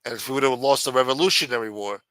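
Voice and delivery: ordinary delivery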